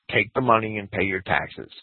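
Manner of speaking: ordinary delivery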